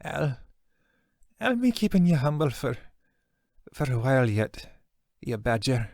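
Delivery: high, halting, breathy-weak teasing; weak wounded woman. Acted. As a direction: tease gently through the pain.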